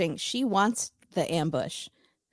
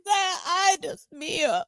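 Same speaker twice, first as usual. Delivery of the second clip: mock telenovela wail; 11 semitones higher than usual. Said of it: "wailing again like a telenovela".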